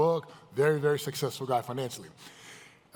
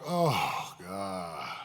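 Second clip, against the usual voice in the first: low, soft, sinking groan; acted private despair; 10 semitones lower, 5 dB quieter than usual. Person alone after the filtered post, acted despair. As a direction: let it sag into a dejected sigh.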